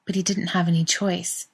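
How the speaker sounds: ordinary delivery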